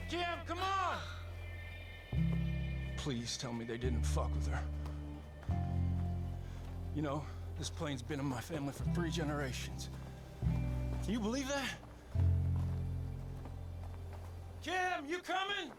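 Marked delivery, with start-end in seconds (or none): 0.1-1.0 high call across distance. Acted. Calling wife from afar. 14.6-15.7 high, drawn-out call. Acted. Husband calling his wife, drawn-out call.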